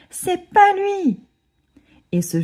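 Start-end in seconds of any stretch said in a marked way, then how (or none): 0.1-1.2 much higher, slow, louder tantrum cry; wailing yell. A narrator's reading. Agnan throwing a tantrum in class, wailing. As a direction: scream like a baby in a fit. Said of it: "hurle Agnan, à bout de nerfs".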